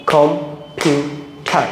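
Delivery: "Computer" very slowly as কম, পিউ, টার, with gaps between; clap-along "computer", one spaced beat each for কম, পিউ, টার; split into syllables. Shown as a demonstration. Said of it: "practical এবার practice করতে হবে".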